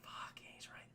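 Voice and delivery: barely audible whisper to oneself; whispered aside. Retold or imitated. Sultan listening in admits it, whispered aside. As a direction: drop to a tiny mock-grudging whisper. Sultan muses while overhearing them.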